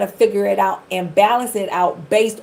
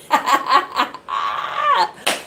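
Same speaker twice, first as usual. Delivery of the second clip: airy laugh, pitched up; breathy high laugh; 10 semitones higher than usual. Real, not acted. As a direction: laugh it off under your breath. Laughs at aging herself.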